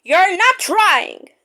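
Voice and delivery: slow stern telling-off; severe. Acted. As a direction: scold the engine sternly. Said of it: "talking to him severely".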